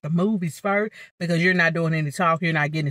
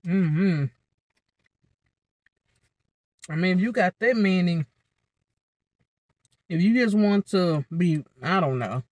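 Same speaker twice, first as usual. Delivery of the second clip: talking between bites of food; eating with a fork; chewing while talking. Real, not acted. Seen 3: Eats from fork throughout; chewing motions, food taken at 5.4.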